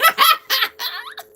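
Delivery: a high, loud burst of laughter; howling laugh; loud cackling laugh. Real, not acted. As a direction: crack up out loud at the joke. Laughing at her own joke.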